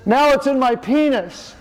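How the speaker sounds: high-pitched, comic distressed dad; father's exclamation. Retold or imitated. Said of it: "He goes".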